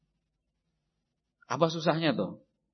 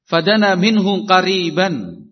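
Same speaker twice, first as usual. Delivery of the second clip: loud Arabic recitation; 11 dB louder, slower than usual. Acted. Quoting hadith in Arabic, loud.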